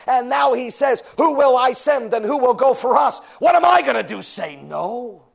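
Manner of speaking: climbing from plain to a loud high shout; building preaching cry. Acted. Preacher proclaims salvation, building to a shout.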